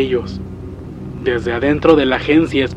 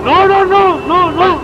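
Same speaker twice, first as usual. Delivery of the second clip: shrieked, very high, loud refusals; terrified screaming; 6 dB louder, slower than usual. Acted. Father as the creature reaches him, screaming in terror. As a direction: scream as it closes in.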